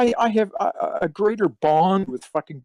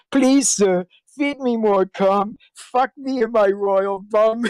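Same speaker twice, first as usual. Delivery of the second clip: higher, slow hammed-up begging; mock pleading; 6 semitones higher, 4 dB louder, slower than usual. Retelling the video the interviewer heard, mock begging. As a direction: plead it out slowly like a pitiful servant. Quoting fan tribute video again.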